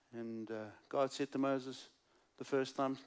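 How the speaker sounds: usual speaking voice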